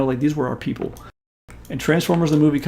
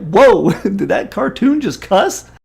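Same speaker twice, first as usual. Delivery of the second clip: put-on shocked kid; 5 semitones higher, 5 dB louder, much slower than usual. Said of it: "You're like".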